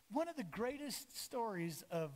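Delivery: ordinary talk, nothing marked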